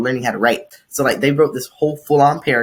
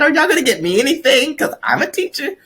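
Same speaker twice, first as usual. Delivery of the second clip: high put-on sweet voice; playfully angle for a gift; high, hammed-up cute pleading; joking wheedle; 13 semitones higher than usual. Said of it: "I was joking".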